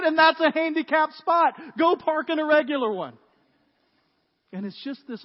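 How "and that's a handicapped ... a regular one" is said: high, loud acted retort; insistent scolding. Rule follower ordering the rule breaker to move, insistent. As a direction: lay down the rule, exasperated.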